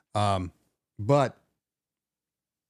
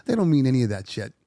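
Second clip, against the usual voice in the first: normal talking voice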